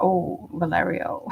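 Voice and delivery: a slow, hushed "oh" of recognition; knowing low mock reaction. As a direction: drop low and quiet like a knowing local. People reacting to the street name.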